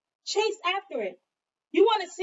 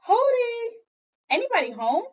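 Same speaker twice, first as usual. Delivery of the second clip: Hody called out high and loud; calling out; 11 semitones higher, 6 dB louder than usual. Acted. Visitor calls out to Mama's hut, high and loud. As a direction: call from outside the hut.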